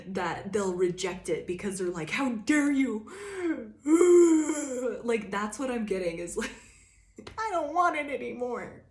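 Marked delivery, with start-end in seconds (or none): 2.1-3.1 high, loud mock-offended toddler; indignant kid. Pissed-off hungry kid rejecting food, loud high indignation. 3.1-5.0 dramatic sigh. Retold or imitated. Grumpy kid refusing food. 7.4-8.8 put-on high whiny toddler refusal; pouty kid. Cranky kid refusing food, high whiny pout.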